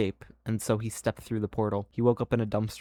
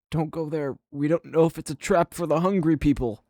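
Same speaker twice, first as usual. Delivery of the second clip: higher-pitched worried warning; higher character voice, warning a friend; Carter; 6 semitones higher than usual. Acted. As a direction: lift the voice into an urgent caution. Carter's warning acted in story.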